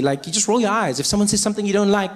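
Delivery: ordinary talk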